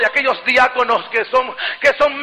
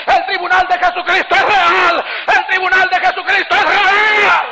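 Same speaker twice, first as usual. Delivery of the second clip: raised, fervent preacher voice building higher; impassioned preaching; 5 semitones higher than usual. Acted. Preacher vowing to tell pastors and believers, impassioned preaching. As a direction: declare it insistently to the whole congregation.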